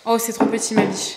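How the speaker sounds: ordinary talk, nothing marked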